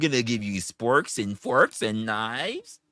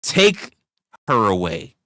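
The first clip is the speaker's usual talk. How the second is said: mock royal command; 10 dB louder, slower than usual. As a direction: bark it like a cruel emperor. Emperor giving an order.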